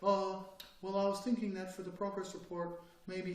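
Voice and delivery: a meek employee hedging in a meeting; timid mumble. Retold or imitated. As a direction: shrink into your chair as you say it. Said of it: "sit in their little chair".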